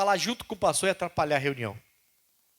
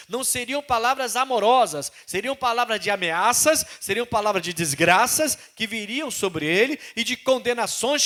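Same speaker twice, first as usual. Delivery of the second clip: loud, raised preacher's cadence; full-force preaching; 7 semitones higher, 8 dB louder than usual. Acted. Preacher about Ezekiel's harsh words, loud preaching. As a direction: thunder it to the congregation.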